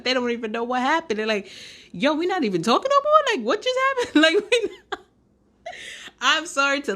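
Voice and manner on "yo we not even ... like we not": high, loud, bewildered mock voice of the dumped guy; mock confused guy. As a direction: squeak out his confusion like a clueless guy. Mimicking confused dumped guys.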